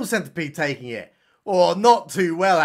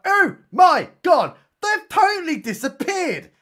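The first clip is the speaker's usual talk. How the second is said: mock shock; 11 semitones higher, 4 dB louder than usual. Narrator revealing the vanished captives, hammed-up high shock. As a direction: ham up the horrified reveal, word by word.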